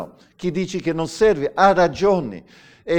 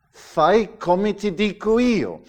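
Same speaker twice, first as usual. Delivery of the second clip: higher, slow, deliberate order; firm command; 6 semitones higher, slower than usual. Acted. Preacher voicing God demanding obedience, firmly commanding. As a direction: lay down the rule slowly, pitched up. Voices God's command to obey.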